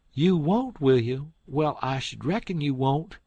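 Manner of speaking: high, loud scornful retort; sarcastic outrage. Acted. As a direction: scoff at his promise. Aunt Sally sarcastic.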